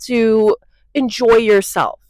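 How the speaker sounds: slow, drawn-out sneering mock voice; mocking quote. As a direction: mimic the snobs slowly and with disdain. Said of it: "I'm so over people being like".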